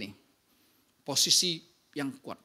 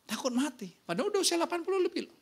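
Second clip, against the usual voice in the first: amazed; 11 semitones higher, faster than usual. Real, not acted. Amazed at the fearful elderly.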